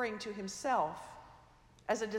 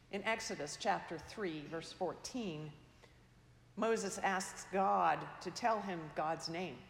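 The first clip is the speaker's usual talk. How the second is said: ordinary delivery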